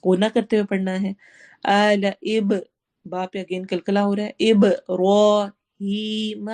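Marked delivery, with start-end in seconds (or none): 4.5-6.5 ابراہیم with a thick ر and a stretched ہی; thick ر, long ہی, slowly; heavy ra, long hee. Shown as a demonstration. Teacher reciting ابراہیم for students. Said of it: "را کو ہم موٹا پڑھیں گے".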